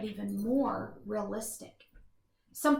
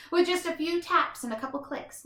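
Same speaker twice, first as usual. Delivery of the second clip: plain reading voice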